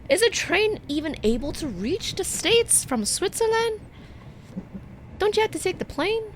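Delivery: high, loud disbelief; shocked, rattle off the questions fast; incredulous. Real, not acted. Streamer baffled by the train route, loud and incredulous.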